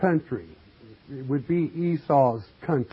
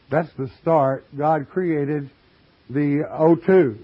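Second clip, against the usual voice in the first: ordinary delivery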